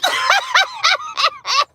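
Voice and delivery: shrill-pitched cackle after the punchline; high cackling laugh. Real, not acted. Laughing at her own joke.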